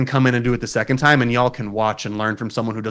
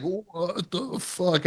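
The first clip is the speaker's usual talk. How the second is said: high, soft, drawn-out amazement; retold stunned disbelief; 8 semitones higher, 7 dB quieter, much slower than usual. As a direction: stretch it out high and quiet, acting dumbfounded. Retold giddy disbelief amid hysterical laughing.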